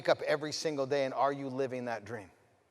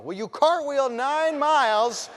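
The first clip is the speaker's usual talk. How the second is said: high, loud, drawn-out daughter's demand; child's big challenge; 8 semitones higher, 5 dB louder, slower than usual. Retold or imitated. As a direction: build to a loud, high-pitched, stretched-out challenge. Daughter's climactic challenge to Dad.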